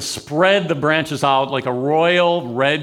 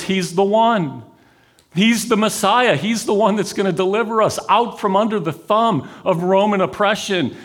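higher, eager voice building with hope; excited crowd rumor; 6 semitones higher than usual. Retold or imitated. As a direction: pass on thrilling news of the Messiah. Crowd excited about the Messiah.

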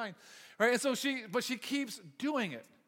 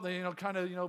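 usual speaking voice

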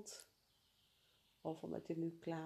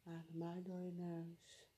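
soft spa whisper; 6 dB quieter than usual. Acted. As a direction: let the cue drift out softly. Soft breath instruction.

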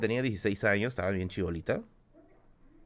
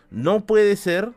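louder, higher, very slow: no puede ser, drawn out; alarmed disbelief; 7 semitones higher, 6 dB louder, slower than usual. Retold or imitated. Mother seeing the stranger jump closer, slow loud alarm. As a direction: drag each word out in dread.

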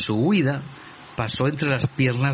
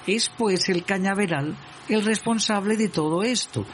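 sweet snake voice; 7 semitones higher than usual. A narrator's reading. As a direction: coax him sweetly in a high voice. Snake pleads sweetly with cousin. (voz aguda y melosa de serpiente)

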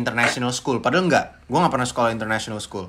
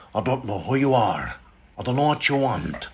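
Liam Neeson; 2 semitones lower, 4 dB quieter than usual. Shown as a demonstration. Father warning a kidnapper, measured menace. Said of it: "Liam Neeson".

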